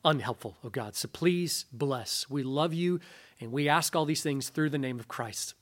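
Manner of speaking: hushed closing prayer. Real, not acted.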